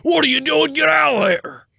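high, loud angry smith; furious shout. Acted. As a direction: bellow at them to leave. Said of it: "the smith is just like".

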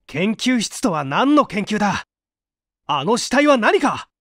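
loud, high angry challenge; angry demand. Acted. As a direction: shout the accusation. Hero confronts suspected killer.

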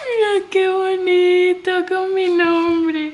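high, loud, drawn-out delight; delighted gushing. Real, not acted. Showing viewers the gift with her name, gushing delight. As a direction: stretch each word in real adoration.

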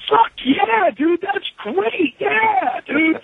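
high, drawn-out stoked cheer; slow, relishing retold elation; excited. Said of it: "I was all excited for it".

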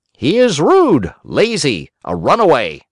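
high, scolding little voice; blunt talking cricket. A narrator's reading. Talking cricket scolds the doctors, high and blunt.

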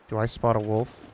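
normal talking voice

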